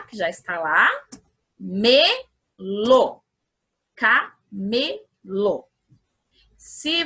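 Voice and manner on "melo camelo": syllable by syllable. Shown as a demonstration. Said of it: "cada vez que eu bato palma, é uma sílaba".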